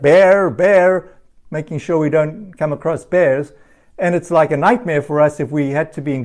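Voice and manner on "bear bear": calling out. Retold or imitated. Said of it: "we hike and we shout".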